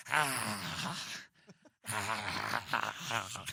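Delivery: low, soft mock sobs; raging man breaking down in tears; mock sobbing. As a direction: break into hammed-up crying. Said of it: "But then he starts to".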